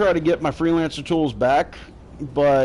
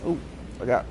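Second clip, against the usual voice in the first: ordinary talk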